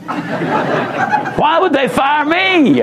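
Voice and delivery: high, slow, laughing disbelief; hammed-up incredulous woman; mock bewildered. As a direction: stretch it out in amused mockery. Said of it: "The inflection in her voice".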